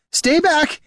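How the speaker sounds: very high, frightened warning; desperate high-pitched cry; panicked shout. A narrator's reading. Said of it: "he shouted".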